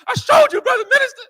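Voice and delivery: high, loud proud boast; triumphant shout. Retold or imitated. Student showing his mentor the results, triumphant shout. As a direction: crow it at your mentor like you just won.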